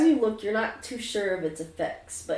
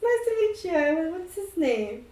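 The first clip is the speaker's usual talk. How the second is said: ghost Denny; 11 semitones higher, 3 dB louder than usual. Acted. Ghost Denny greets Isla.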